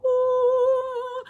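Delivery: loud, high, sung-out ooh; sustained high whoosh of a note; long high ooh. Retold or imitated. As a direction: imitate a heavenly arrival with one held note. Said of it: "Jesus is gonna come and be like".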